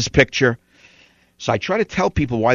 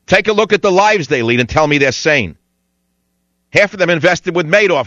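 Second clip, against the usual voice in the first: ordinary talk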